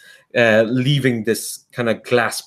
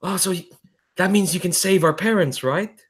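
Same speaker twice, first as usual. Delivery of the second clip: put-on hopeful girl; 8 semitones higher than usual. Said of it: "the girl's kinda like".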